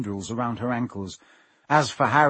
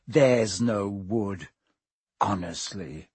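lower, slow mock whine of her words; 4 semitones lower, slower than usual. A narrator's reading. Ron mimicking Hermione's panicked cry, low slow mockery. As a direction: parrot her panic back scornfully.

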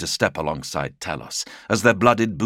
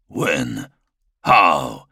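rising anger; 3 semitones lower, 5 dB louder than usual. Acted. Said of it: "snarled the words, his anger mounting".

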